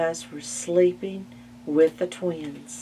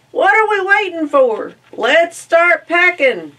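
very high, loud eager call; eager cry; 12 semitones higher, 11 dB louder than usual. Acted. Ma and Pa urging everyone to the barn, very high loud. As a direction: cry it out, raring to go.

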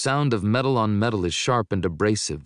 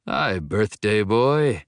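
slow, louder, taunting menace; mocking villain greeting; 2 semitones higher, 7 dB louder, slower than usual. A narrator's reading. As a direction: savour each word like a cruel joke. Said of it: "laughing as he steps into the light".

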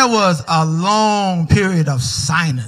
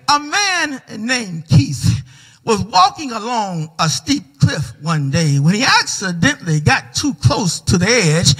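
normal talking voice

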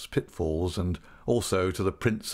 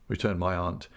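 ordinary delivery

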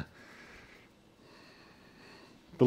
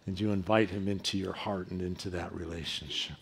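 soft invitation; 8 semitones lower, 11 dB quieter than usual. Real, not acted. Altar call, soft invitation.

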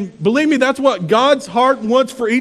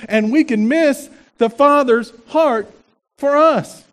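normal talking voice

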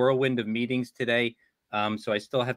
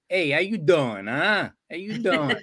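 New York greeting; 3 semitones higher, slower than usual. Retold or imitated. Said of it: "we used to be like".

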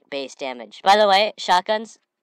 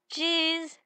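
usual speaking voice

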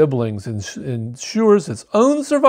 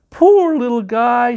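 cooing; 7 semitones higher, 3 dB louder, slower than usual. Acted. Harriet coos to the fallen baby bird, gentle and slow. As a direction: coo over the little bird. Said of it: "she cooed".

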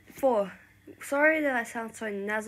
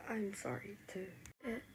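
very soft, hushed apology and reassurance; quiet and sincere; gentle reconciliation; 10 dB quieter than usual. Acted. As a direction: speak tenderly, almost whispering. Two friends apologise and reconcile.

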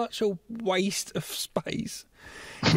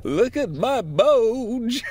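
loud, slow swooping theatrical boast; hammed-up croon, higher and drawn out; 4 semitones higher, 6 dB louder, much slower than usual. Goblin King showing off to Sarah, hammed-up croon. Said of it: "He says to her".

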